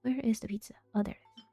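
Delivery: ordinary delivery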